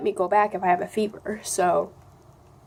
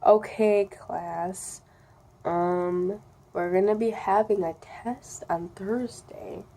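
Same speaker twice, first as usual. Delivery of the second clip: slow-talking science teacher; 2 semitones lower, 4 dB quieter, slower than usual. Retold or imitated. Kid imitating her slow teacher for viewers, droning. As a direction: drone on like a dull teacher. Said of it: "pretend that I'm him".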